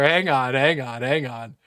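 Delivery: usual speaking voice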